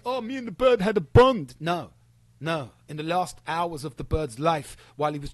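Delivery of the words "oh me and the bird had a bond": high, loud sneering mimicry; mocking sappy voice; mocking quote. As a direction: mimic him like a sappy fool. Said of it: "And you think".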